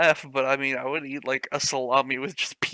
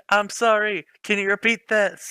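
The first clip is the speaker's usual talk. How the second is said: higher, louder hammed-up reply; mock character voice; 6 semitones higher, 7 dB louder than usual. Reading the fanfic heroine's line aloud, hammed-up. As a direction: overplay the girl's polite question.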